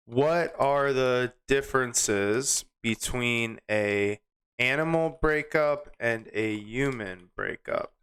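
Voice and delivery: slow, spaced-out words; typing aloud. Real, not acted. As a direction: say it slowly as you type it. Types the prompt while saying it.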